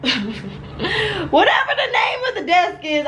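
high, drawn-out mock wail with a laugh; playful exasperation. Real, not acted. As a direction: whine it high and slow, half laughing. Playfully giving up on the name.

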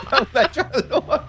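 said through laughter, quick; laughing. Real, not acted. Teammate laughing about losing soon, quick. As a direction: giggle through the words.

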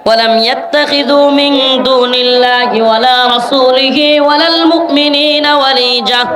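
slow, melodic chanted recitation; Quran recitation. Acted. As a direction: intone the Arabic verse, drawing out the end.

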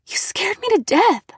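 high, shaken reproach; shaken stammer. Acted. Girl pushes Nate back, shaken reproach. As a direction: blurt it out, high from fright. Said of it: "I stammered".

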